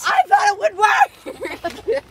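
slow, high, loud whine; loud protest. Real, not acted. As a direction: drag it out loudly, half laughing. Defending herself, laughing.

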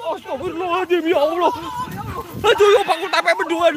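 high, rattled outcry; high-pitched panicked exclamation; startled dismay. Real, not acted. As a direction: yelp out in shock after a scare. Prayer broken off by scare.

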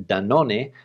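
Danone said the Spanish way, da-NO-neh; Spanish pronunciation. Shown as a demonstration. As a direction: say Danone in three syllables, ending in -eh. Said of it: "I used the Spanish pronunciation".

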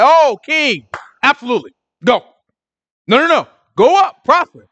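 high, loud mock agreement; sarcastic. Micaiah mocking the king with sarcasm, high and loud. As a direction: lay the sarcasm on thick, pitch up. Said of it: "Micaiah said it sarcastically".

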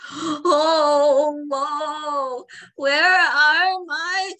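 high, loud, slow mock wail. A narrator's reading. Old man waking without his toes, wailing. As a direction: moan it out like a hammed-up lament. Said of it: "he wailed".

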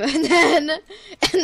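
giggling through the words; laughing. Real, not acted. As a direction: laugh as you say it. Laughing while introducing skin.

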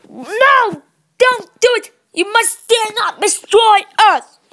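high, loud pleading cries; pleading shout. Pretend. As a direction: yell it up high, begging them to stop. Pretend play, pleading shout.

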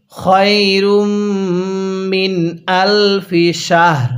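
slow chant of খয়রুম মিন আলফি শাহর; tajweed chant; slow recitation. Shown as a demonstration. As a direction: draw out each word as in Quran reading. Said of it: "হ্যাঁ পড়ুন".

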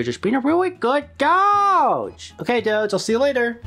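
high, sing-song pet voice. Real, not acted. Cooing to the dog.

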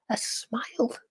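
soft hushed wonder; whispering. A narrator's reading. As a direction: whisper it gently. Tender hush.

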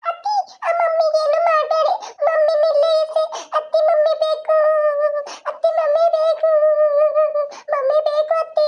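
put-on high, tearful small child's voice begging; pleading little child. Little boy begging aunt not to take mummy away, tearful child voice. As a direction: whimper like a small kid clinging to his mother.

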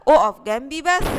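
a loud, bossy letter Ra; letter talking. Acted. Teacher voices the letter Ra scolding Ghain, loud. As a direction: tell the next letter off loudly. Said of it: "بتقول له".

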